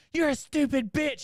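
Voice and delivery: high, loud mock comeback; angry retort. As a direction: act a kid yelling back at his mother. Son yelling back at mom.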